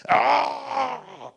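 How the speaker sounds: long, high wail falling away; imitated demon scream. Devil answering the pastor, long high imitated scream. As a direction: let out a drawn-out mock scream.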